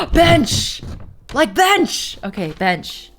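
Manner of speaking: high, loud gleeful exclaiming; real bubbly excitement; too excited. Real, not acted. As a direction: cheer it like a thrilling announcement. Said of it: "I got too excited".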